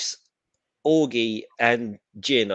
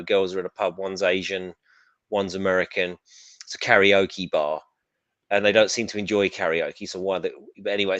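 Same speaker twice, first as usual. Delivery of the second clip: usual speaking voice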